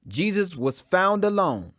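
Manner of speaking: usual speaking voice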